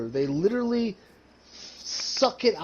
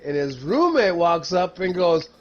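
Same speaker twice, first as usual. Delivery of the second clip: ordinary talk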